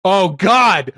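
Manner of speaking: loud, high cry of disbelief; shocked outburst at the picture; real exclamation. Real, not acted. Reacting to the bucket picture.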